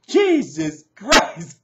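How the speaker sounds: loud, drawn-out exclamation; appalled outburst. Real, not acted. Vlogger reacting to a freakish act, loudly appalled. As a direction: stretch each word in exasperation.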